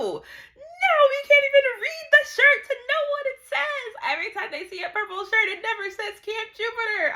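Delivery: very high, loud wail of frustration; frustrated outburst. Acted. Reader reacting to the missed shirt clue, frustrated shriek. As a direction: wail it in exasperation, easing off at the end. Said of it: "I'm getting so frustrated".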